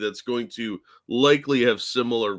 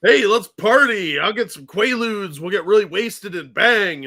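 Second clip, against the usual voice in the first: mock Cosby voice; 7 semitones higher, 5 dB louder than usual. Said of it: "Cosby was like".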